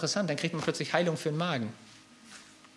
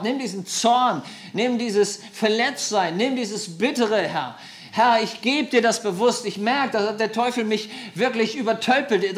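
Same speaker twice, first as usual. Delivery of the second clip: louder, higher, impassioned praying; rising ecstatic prayer voice; fervent loud prayer; 4 semitones higher, 5 dB louder than usual. Real, not acted. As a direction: pour it out to God.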